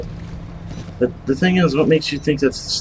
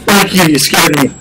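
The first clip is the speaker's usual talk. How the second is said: startled yell, louder and higher; scream into giggles; 6 semitones higher, 9 dB louder than usual. Real, not acted. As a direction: shout after a jump scare. Jump scare from monster.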